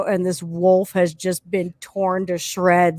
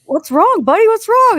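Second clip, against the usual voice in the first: higher, louder alarmed "what's wrong"; worried parent; 13 semitones higher, 7 dB louder than usual. Retold or imitated. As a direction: call out to a panicking kid, alarmed. Parent asks crying son what's wrong.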